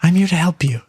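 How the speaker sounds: lightly voiced fairy godmother. Godmother offering Tina her help, lightly voiced fairy godmother. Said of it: "fairy godmother".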